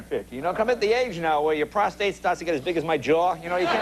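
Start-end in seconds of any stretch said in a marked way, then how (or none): none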